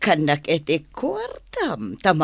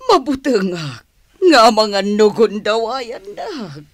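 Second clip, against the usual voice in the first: tearful plea; 5 semitones higher, 4 dB louder than usual. Acted. Wife pleads, crying heard.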